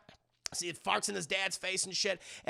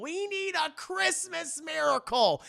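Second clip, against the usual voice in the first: mock desperate plea; 9 semitones higher than usual. Rudolph desperate, too late, acted.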